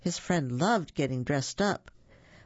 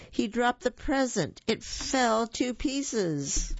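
dramatic dismay; 7 semitones higher, slower than usual. A narrator's reading. Gift falls and breaks.

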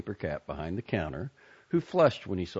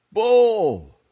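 a big shout, much higher and louder; excited shout; 16 dB louder than usual. A narrator's reading. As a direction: yell it out with delight. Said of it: "shouted out".

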